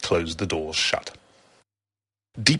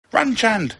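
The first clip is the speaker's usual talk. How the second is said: high urgent warning yell; urgent, high-pitched cry; 4 dB louder than usual. A narrator's reading. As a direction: yell for a friend to flee. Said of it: "yelled Alice".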